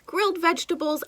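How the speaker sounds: usual speaking voice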